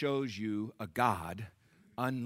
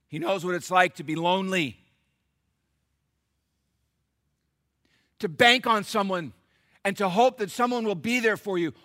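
louder, higher preaching on the abandonment; rising preaching; 10 semitones higher, 12 dB louder than usual. Acted. Pastor preaching on loneliness, growing louder. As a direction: build it up for the church.

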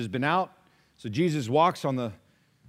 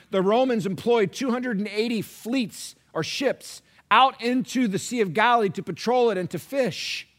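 louder, driving sermon voice; fiery preaching; 8 semitones higher, 7 dB louder than usual. Acted. Pastor pressing the congregation on who is really their king, preaching hard. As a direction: build it like a pulpit climax.

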